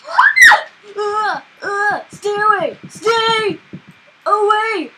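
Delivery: loud, high panicked yells; real panic shouting; terrified scream. Real, not acted. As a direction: shriek to keep it away. Screams at attacking witch.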